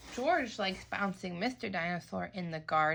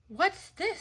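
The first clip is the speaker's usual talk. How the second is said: curious; 11 semitones higher than usual. Acted. Peppa finds something.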